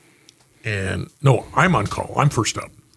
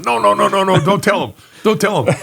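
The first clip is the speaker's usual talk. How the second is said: high, fast pleading no no no; mock panic, pitched well up; panicked pleading; 8 semitones higher than usual. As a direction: beg him not to tell the boss. Buddy panics, begs not to tell.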